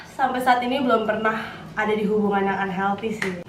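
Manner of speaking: ordinary delivery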